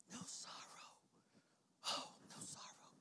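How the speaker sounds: hushed, savouring whisper; whispered awe. Real, not acted. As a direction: breath-only whisper.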